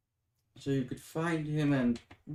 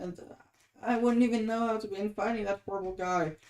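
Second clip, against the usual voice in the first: ordinary delivery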